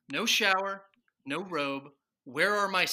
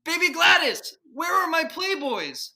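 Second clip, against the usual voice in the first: frantic demand; 7 semitones higher, 7 dB louder than usual. Acted. Father asks baby for his magazines, desperate shout. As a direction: yell it in desperation.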